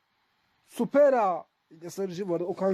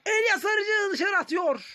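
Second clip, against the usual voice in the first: excited commentator shout; 11 semitones higher, slower than usual. Real, not acted. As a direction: yell it like a live commentator. Chance in the game.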